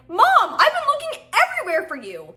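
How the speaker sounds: high-pitched, acted cry to Mom; hammed-up child finding mother; relieved child calling mom. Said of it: "And I was like".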